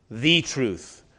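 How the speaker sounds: "the" said long and weighty as THE truth; stress on THE. Shown as a demonstration. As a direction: lean on the article "the" before "truth". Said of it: "but not in".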